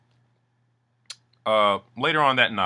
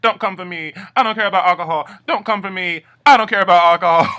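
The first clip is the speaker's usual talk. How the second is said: much higher, louder fast yelling; mock tantrum; 11 semitones higher, 10 dB louder, faster than usual. Suki raging at Imran over the alcohol, loud high yell. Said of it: "she's like".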